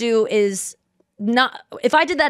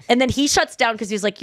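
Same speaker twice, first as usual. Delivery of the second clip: ordinary delivery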